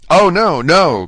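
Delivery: loud, high protesting cry; cried out. A narrator's reading. Dante cries out to the doubting voice, loud and high. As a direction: burst out in desperate denial. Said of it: "cried Dante".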